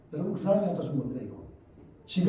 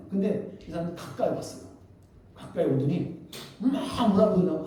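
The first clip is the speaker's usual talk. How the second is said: usual speaking voice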